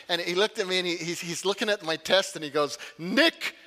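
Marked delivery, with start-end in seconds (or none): 3.0-3.5 slow, much higher and louder Scottish pastor voice, drawing out the angry man; broad Scottish accent, slow and booming. Retold or imitated. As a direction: imitate the old Scottish pastor delivering the verdict. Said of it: "an older Scottish pastor with a great Iri-, uh, Scottish accent".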